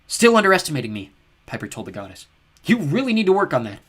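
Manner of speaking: loud, high taunt; mock her confidently. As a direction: Piper taunting the goddess. Piper taunting the goddess.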